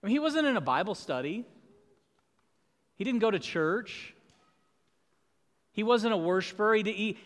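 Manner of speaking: normal talking voice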